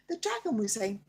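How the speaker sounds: ordinary delivery, nothing marked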